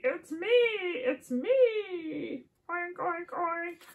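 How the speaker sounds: high, stretched-out piggy reveal; excited pig. Acted. Pig reveals itself under the mask, high excited voice. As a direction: cry it slowly, then add little high oinks. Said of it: "says the pig".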